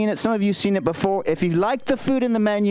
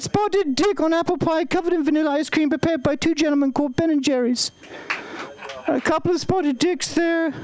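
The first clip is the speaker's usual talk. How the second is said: Queen of England; 5 semitones higher than usual. Acted. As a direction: do a stately old queen, higher pitched. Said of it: "Here you go, the Queen".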